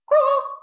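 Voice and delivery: loud, high frog croak; a sudden loud croak. A narrator's reading. Frog croaks loudly and high.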